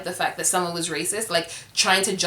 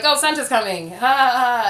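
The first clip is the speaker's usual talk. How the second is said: higher, brushing it off with a forced ho ho; fake awkward laugh; 5 semitones higher, 5 dB louder than usual. Parents at the dinner table dodging the kid's remark, forced laugh. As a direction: laugh it off nervously to dodge the moment.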